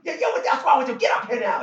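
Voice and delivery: high, loud abusive yelling; imitated hectoring shout; Don Rickles. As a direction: berate someone from the stage. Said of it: "He's just yelling, abusing me".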